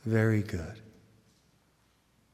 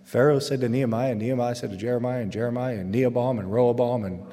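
fast, jumbled list of names; a kid hearing gibberish, fast; mock rattled-off reading. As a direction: rattle it off like nonsense. Mocking confusing readings.

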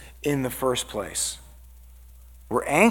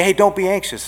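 higher, louder dismissive reassurance; glib advice; 6 semitones higher, 6 dB louder than usual. Acted. Preacher showing what you can't just say, glib and loud. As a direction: mimic someone briskly brushing worry away. Mimicking useless advice to the anxious.

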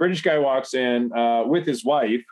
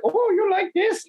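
very high, drawn-out falsetto; mock woman's voice. Woman asks him in bed, high mock falsetto. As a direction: put on a teasing woman, pitched way up.

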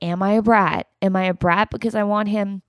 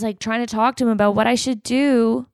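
ordinary talk

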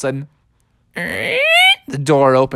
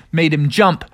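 plain reading voice

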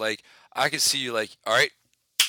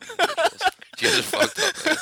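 laughing; 4 dB louder than usual. Retold or imitated. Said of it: "and I was like".

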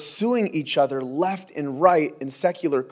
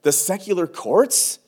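urgent pleading; 7 semitones higher than usual. Retold or imitated. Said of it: "Paul says".

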